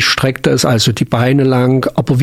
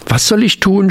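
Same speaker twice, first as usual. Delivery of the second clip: frightened blurt; 7 semitones higher than usual. A narrator's reading. Little frog blurting its panic at the old frogs. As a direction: burst out high and anxious. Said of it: "stieß voller Angst das Laubfröschlein hervor".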